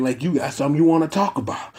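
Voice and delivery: normal talking voice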